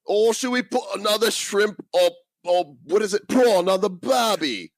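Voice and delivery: high, hammed-up Australian accent; mock Australian accent. Mock Australian stereotype to tease reviewer.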